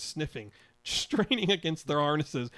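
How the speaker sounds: usual speaking voice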